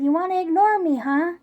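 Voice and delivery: loud, high, challenging call. Retold or imitated. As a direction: shout it over to the neighbour who ignores you. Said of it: "I said even louder".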